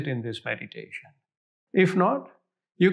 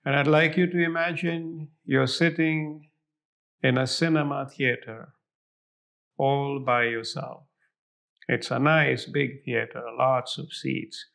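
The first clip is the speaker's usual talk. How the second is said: guided meditation; 3 semitones lower, 3 dB quieter than usual. Acted. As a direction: fade softer toward silence. Guided visualization meditation continues.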